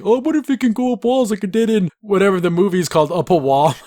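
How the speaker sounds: high, loud goofy voice; dopey Zach. Retold or imitated. Driver Zach suggesting the car drive up walls, high and goofy. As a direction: pitch it up like a clueless sidekick. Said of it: "Zach's kinda being like".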